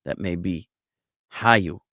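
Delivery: hayu with stress on HA; stress on first syllable. Shown as a demonstration. Teacher showing past tense stress on HA. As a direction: lean on the HA of hayu. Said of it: "emphasis would be on the ha".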